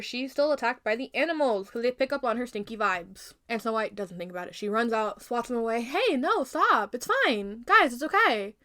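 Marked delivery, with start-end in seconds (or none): 5.8-8.5 higher, louder, calling off pets; raised-pitch pleading to back off; urgent shooing. Acted. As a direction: shoo them off, insisting it's okay.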